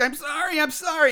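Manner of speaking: high, plaintive imitation of a tearful apology; mock crying. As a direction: plead as if starting to cry. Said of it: "he starts crying".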